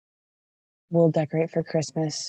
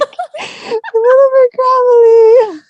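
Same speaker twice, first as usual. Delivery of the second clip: laughing; 10 dB louder, much slower than usual. Real, not acted. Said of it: "when I get in my lower register".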